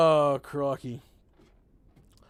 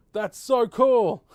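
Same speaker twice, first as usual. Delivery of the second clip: louder, drawn-out delight; excited, louder; 7 semitones higher, 9 dB louder, much slower than usual. Real, not acted. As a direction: really savor it, slow and loud. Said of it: "repeats delight".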